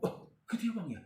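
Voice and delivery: a friend startled by the salary figure; surprised. Retold or imitated. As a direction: react as if caught off guard. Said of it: "Kaget dia".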